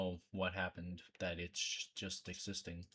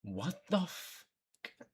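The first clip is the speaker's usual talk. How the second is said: slow, higher-pitched shocked reaction; stunned disbelief; 6 semitones higher, much slower than usual. Real, not acted. Streamer reacting to a grim news story, stunned. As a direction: draw it out in real disbelief.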